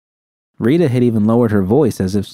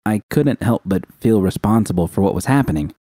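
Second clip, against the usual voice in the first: ordinary delivery, nothing marked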